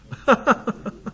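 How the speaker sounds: short, high chuckle; amused laugh. Real, not acted. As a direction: laugh at the comeback. Amused by the comeback.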